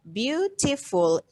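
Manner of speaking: BEAUTIFUL slowly split into its syllables. Shown as a demonstration. Teacher counting syllables for the class, BEAUTIFUL said slowly in separate syllables. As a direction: sound out BEAUTIFUL slowly, one syllable at a time. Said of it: "how many syllable of beautiful".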